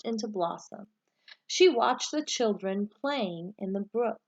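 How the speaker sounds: put-on reading a picture book aloud to children, lively and rising at each new season; bright, expressive read-aloud storyteller voice; story narration. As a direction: tell it like story time, lifting the start of each season. Teacher reads storybook aloud.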